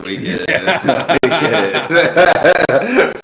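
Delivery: laughing through the words; slow teasing laugh. Real, not acted. Teasing a bragging teammate.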